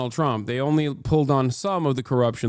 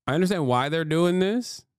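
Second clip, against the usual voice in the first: usual speaking voice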